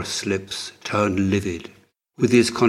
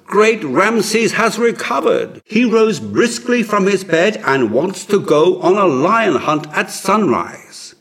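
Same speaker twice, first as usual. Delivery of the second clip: excited announcement; 10 semitones higher, 6 dB louder than usual. Acted. Messenger announcing joyful news.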